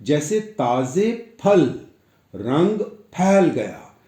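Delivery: फल and फैल said slowly with aspirated ph; careful, slow ph in फल and फैल. Shown as a demonstration. Teacher reading example words, slow aspirated ph. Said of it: "कुछ और उदाहरण".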